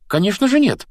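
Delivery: high, fast reassurance; gabbled, over-quick denial; hurried gabbling. A narrator's reading. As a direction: rattle it off in a high girl's voice. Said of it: "затараторила Гермиона".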